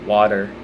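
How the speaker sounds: "Water" as "WAH-der". Shown as a demonstration. Showing viewers her way, "Water" as "wah-der". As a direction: say "Water" loud with a d for the t. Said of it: "with the T's being a D".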